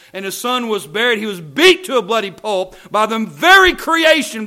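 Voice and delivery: loud, high, impassioned pulpit cry; full-force preaching. Real, not acted. Preacher telling congregation of Calvary, full-force preaching. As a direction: build to a thundering sermon peak.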